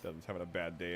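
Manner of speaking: normal talking voice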